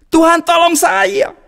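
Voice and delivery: high, slow pleading call; pleading cry. Acted. Man calling to God as water rises, slow high plea.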